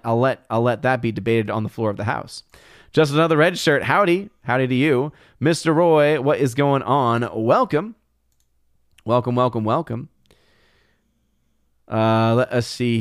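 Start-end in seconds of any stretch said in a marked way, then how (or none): none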